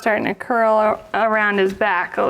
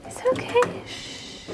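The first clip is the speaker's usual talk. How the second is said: soft high shh to soothe; rhythmic shushing; 11 semitones higher, 9 dB quieter than usual. Real, not acted. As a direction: hush the puppy gently. Soothing scared puppy.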